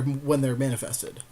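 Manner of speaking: ordinary talk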